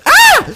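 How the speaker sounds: a scream that escapes before he can stop it; real startled scream. Real, not acted. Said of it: "C'était même pas prévu". (cri de surprise soudain et perçant)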